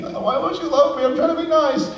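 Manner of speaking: high whining plea, a touch hammed-up; mock whine. Wondering why the supervisor hates her, high mock whine. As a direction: whimper like a rejected puppy.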